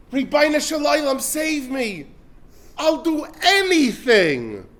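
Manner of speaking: high, loud, panicked cry to God; desperate plea. Retold or imitated. Man hanging from a breaking branch begs God, panicked. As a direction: beg for your life from the branch.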